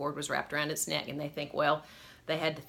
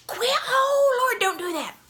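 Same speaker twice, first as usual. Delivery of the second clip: much higher and louder, alarmed country woman; country lady; 6 dB louder than usual. Retold or imitated. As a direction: cry out like a startled old country lady. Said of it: "this one lady goes".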